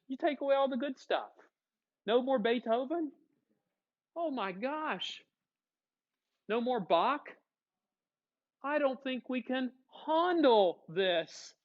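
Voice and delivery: high-pitched, hammed-up distress; mock dismay. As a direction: lament it theatrically, pitched well up, drawing out the last words. Acted dismay of symphonies losing composers.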